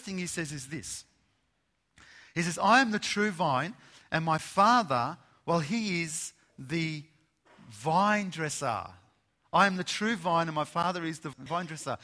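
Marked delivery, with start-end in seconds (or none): 2.6-12.0 loud, ringing preacher's voice; voicing Jesus boldly to the congregation, preaching loud. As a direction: proclaim it from the pulpit. Preacher quotes Jesus, full force.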